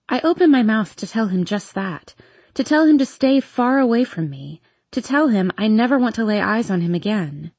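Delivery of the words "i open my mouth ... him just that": plain reading voice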